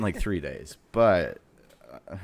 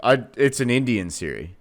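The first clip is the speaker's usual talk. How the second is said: usual speaking voice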